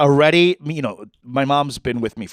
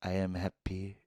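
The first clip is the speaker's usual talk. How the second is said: mom's quiet tipsy contentment; quiet drunk reply; 7 semitones lower, 17 dB quieter than usual. Retold or imitated. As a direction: say it softly like a tipsy mom. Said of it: "She goes".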